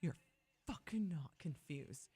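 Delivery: hissed whisper. Real, not acted. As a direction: whisper it under your breath. Irritated aside.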